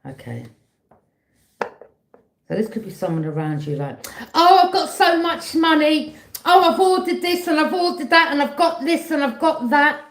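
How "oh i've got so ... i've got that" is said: much higher and louder, bragging mockery; high loud hammed-up boasting; show-off. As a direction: brag over the top like a show-off. Mocking a show-off.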